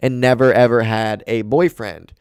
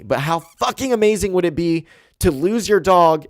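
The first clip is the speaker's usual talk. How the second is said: usual speaking voice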